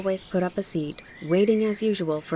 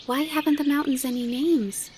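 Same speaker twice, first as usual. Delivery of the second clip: put-on high little-girl voice; high-pitched, wondering question; curious child Heidi; 9 semitones higher than usual. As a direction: ask like a puzzled child.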